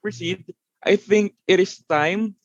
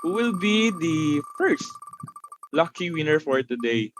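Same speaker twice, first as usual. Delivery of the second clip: hyped giveaway announcement. Acted. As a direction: build suspense for the draw. Hosts drawing raffle winners.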